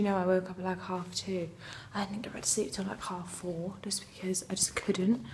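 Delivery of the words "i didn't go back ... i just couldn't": soft whisper so as not to wake anyone. Real, not acted. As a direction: hush it, barely voiced. Quiet early-morning vlog talk.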